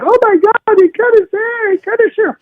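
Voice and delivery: shrill high gasp of amazement; shocked onlooker. Retold or imitated. Trump mimicking golf staff seeing his hair, high squeal. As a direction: squeal it like a stunned bystander. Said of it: "and I was like".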